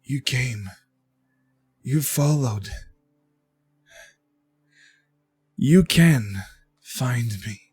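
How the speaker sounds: slow, spaced-out greeting; faintly smiling warrior. Acted. Warrior Elixion greets the one who followed him, faintly smiling. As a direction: welcome the follower with a faint smile in the voice. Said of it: "with a faint smile and says".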